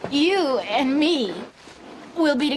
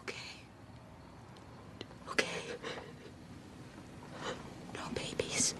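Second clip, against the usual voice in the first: barely voiced, much softer; resigned whisper; 20 dB quieter, faster than usual. Daughter yielding to her mother, whispered resignation. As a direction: give in under your breath.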